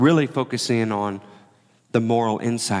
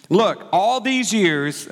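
slow, higher, resentful complaint; sulky tantrum; 6 semitones higher, much slower than usual. Acted. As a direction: whine it out like a wronged son. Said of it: "He's throwing a tantrum".

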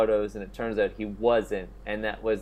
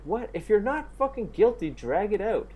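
indignant; 6 semitones higher than usual. Real, not acted. Outraged at settling.

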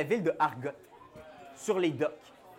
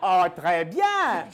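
dock worker voice; 5 dB louder, much slower than usual. Acted.

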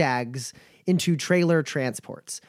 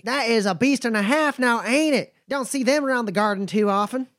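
higher, folksy drawling caravan hand; a wary but friendly trail boss sizing folks up; nomad Bumper; 7 semitones higher than usual. Acted. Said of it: "He goes".

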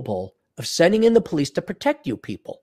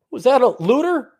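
mock surprise; 8 semitones higher than usual. Mock cop spotting a looter.

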